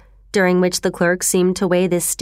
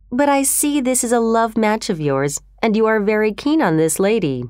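plain reading voice

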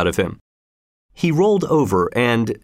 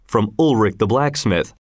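ordinary delivery, nothing marked

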